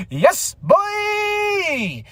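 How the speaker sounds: excited shout. Real, not acted. Fan hyped about a three-way monster match, yelling. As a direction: let it ring out like a fan. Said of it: "I was just like".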